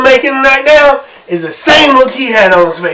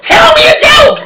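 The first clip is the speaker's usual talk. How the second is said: very high squeal; hammed-up high squeal; mock squealing fan; 4 dB louder than usual. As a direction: beg like an excited fan. Mock women begging him.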